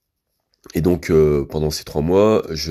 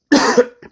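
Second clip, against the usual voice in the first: short unvoiced cough; coughing fit; 7 dB louder than usual. Real, not acted. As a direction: clear the throat with a cough. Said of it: "je tousse".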